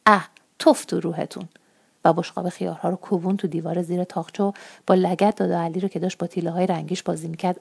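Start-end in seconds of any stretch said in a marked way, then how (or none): none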